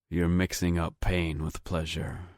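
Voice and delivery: ordinary delivery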